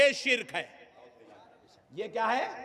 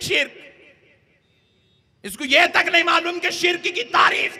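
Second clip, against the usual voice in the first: fiery preaching; 6 semitones higher than usual. Acted. Preacher rousing the congregation, fiery preaching. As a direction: hammer it home to the crowd.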